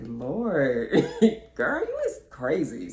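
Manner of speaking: laughing through the words; laughing disbelief. Retold or imitated. Teasing her friend, laughing through it. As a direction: burst out laughing mid-line. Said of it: "And I was like".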